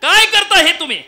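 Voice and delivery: high, full-voiced yell of protest; outraged shout. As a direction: act a servant bellowing at his master, appalled. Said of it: "मोठ्यानं ओरड-, ओरडला". (रागाने मोठ्यानं ओरडणे)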